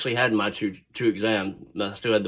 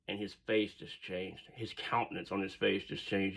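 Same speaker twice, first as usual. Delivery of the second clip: ordinary delivery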